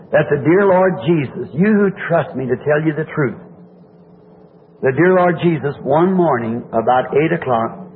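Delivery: ordinary talk, nothing marked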